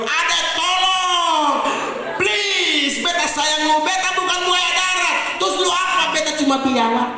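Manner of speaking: bang on the door and beg; desperate begging. Pretend. Laki-laki memohon di pintu. (rayuan putus asa berteriak)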